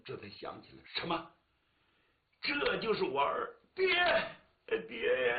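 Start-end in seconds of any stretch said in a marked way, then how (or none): 2.4-5.4 high, choked weeping call; father and son crying as they reunite; tearful cry. Acted. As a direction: sob out the reunion.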